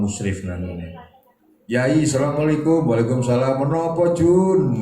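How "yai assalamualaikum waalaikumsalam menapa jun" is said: higher and louder voicing of student and teacher greeting; acted dialogue. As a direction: call out the greeting like an eager student. (dialog santri dan kyai diperankan lebih tinggi)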